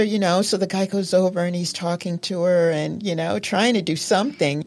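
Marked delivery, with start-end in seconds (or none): none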